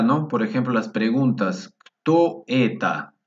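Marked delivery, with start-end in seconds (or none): 1.8-3.1 slow, higher-pitched question with a falling end; slow teaching delivery of a question; drawn-out model question. Shown as a demonstration. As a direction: stretch "Kto" high and louder, then fall.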